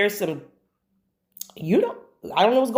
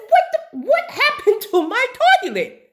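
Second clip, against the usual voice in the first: much higher, louder, half-laughing disbelief; shocked outcry; 3 dB louder than usual. Retold or imitated. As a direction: yelp it out at the broken toilet. Seeing the broken toilet.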